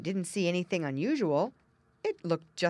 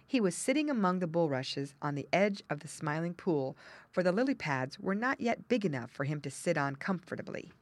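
ordinary delivery

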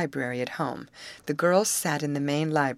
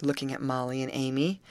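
usual speaking voice

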